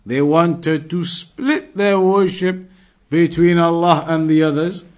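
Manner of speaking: ordinary talk, nothing marked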